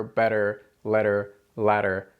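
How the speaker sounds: better, letter, ladder with a quick flapped t; tongue flaps the roof once. Shown as a demonstration. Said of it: "same sound that exists in words like butter".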